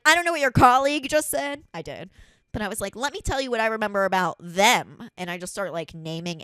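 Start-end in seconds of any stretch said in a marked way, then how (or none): 0.0-1.6 loud, high retort to an officer; acted-out indignant comeback; re-enacted argument. 3.0-5.1 loud, insistent retort; acted-out raised-voice challenge; re-enacted confrontation. Acted quarrel with the officer.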